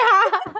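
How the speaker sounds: loud laughing high "Ha"; imitating own laugh. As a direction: bark a high silly "Ha". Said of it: "and then I went, like".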